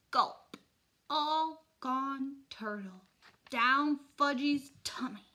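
put-on high, slow toddler announcing proudly; slow baby talk. A narrator's reading. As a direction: draw it out like a little child. Toddler announces he swallowed turtle.

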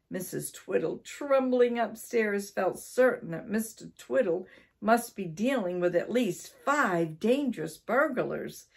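usual speaking voice

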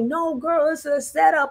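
high, urgent warning to a movie character; pleading with the girl on screen; urging the screen. Retold or imitated. Talking to a movie heroine, high urgent warning. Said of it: "I was like".

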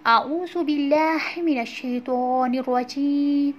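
slow, melodic tajweed recitation; drawn-out chanted recitation; Quran recitation. Shown as a demonstration. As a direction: recite each verse slowly and evenly. Teacher recites surah slowly.

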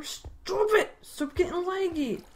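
high-pitched exasperated plea. Real, not acted. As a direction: scold the game in a high voice. Scolding the lagging game.

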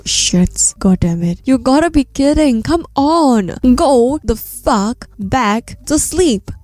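ordinary delivery, nothing marked

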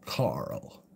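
low breathy whisper of the name; whispered Rick Grimes. Retold or imitated. Streamer repeating his Rick Grimes impression, whispered. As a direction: whisper it like a grieving dad. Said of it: "That's me Nick Grimes impression".

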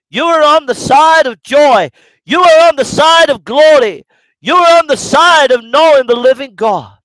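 loud, high preaching shout; shouting preacher. Real, not acted. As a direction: lift it up and cry it out to the crowd. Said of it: "think I'm shouting in as I'm preaching".

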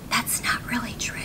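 whispered aside; breathy unvoiced whisper to the listener; a quick whispered correction. Real, not acted.

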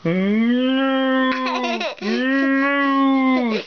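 stretched playful moo; cow mooing. Pretend. Mother reading a picture book to her child, mock moo. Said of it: "says the cow".

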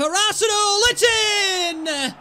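high, loud shout; real excitement; excited goal call. Real, not acted. Goal against former club.

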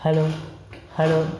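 usual speaking voice

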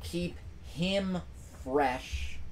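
normal talking voice

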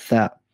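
"Tha" mit Zunge zwischen den Zähnen; "Tha" wie ein gelispeltes T; lispelndes Tha. Shown as a demonstration. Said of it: "wenn man das Ta ein bisschen etwas lispelnd ausspricht". ("Tha" lispelnd, Zungenspitze sichtbar)

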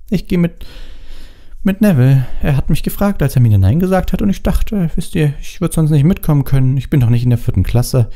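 put-on high, hesitant girl confessing; sheepish admission, pitched well up; miserable. A narrator's reading. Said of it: "Sie sah ganz elend aus".

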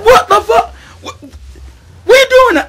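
high, loud startled yell at an unseen pusher; retold terrified scream; panicked shout. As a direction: shriek it like you just leapt out of bed. Jumped out of bed in fright.

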